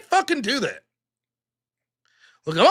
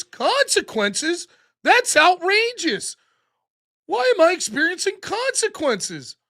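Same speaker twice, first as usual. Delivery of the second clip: mock outraged woman; 7 semitones higher than usual. Streamer mocking the arrested woman, whiny mock outrage. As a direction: drag out the outrage like a spoiled brat.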